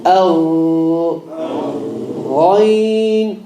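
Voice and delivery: Al. with a smooth gargle, no click; continuous ghain. Shown as a demonstration. Teacher showing the smooth letter to the class. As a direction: glide through Al. without a click. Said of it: "flow should be continuous".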